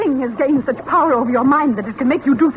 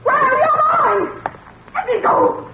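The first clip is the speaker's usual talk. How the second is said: struggling against the doctor holding her; panicked. Acted. Wife held back at clinic.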